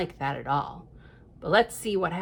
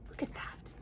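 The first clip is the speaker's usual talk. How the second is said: whisper; 16 dB quieter than usual. Real, not acted. Points at the picture.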